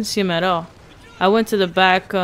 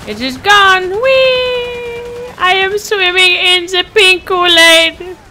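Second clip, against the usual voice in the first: much higher, louder giddy squeal; 10 semitones higher, 7 dB louder, slower than usual. Pretend. Player squeals giddily swimming in pink Kool-Aid. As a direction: squeal with silly joy.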